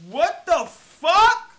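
slow, loud, rising disbelief; shocked outburst. Real, not acted. Streamer reacts to what just happened, loudly incredulous. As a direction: stretch it out and climb high in shock.

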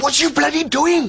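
indignant outburst. Acted. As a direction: cut in, appalled.

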